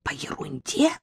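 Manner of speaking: hushed, tense half-whisper; frightened whisper. Acted. Nadezhda whispers to her husband on the phone, scared. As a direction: hiss it so no one overhears. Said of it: "страшным шёпотом отозвалась Надежда".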